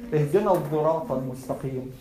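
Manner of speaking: الصراط with a voiced "dh/z" in place of "s"; Al-Kisa'i "dh" reading. Shown as a demonstration. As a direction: pronounce الصراط as "adh-dhirat". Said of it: "this is the narration of Al-Kisa'i".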